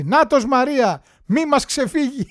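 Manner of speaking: hammed-up slow yell; mock shout. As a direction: call out like a scheming mother-in-law. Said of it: "άκουσα την πεθερά να φωνάζει".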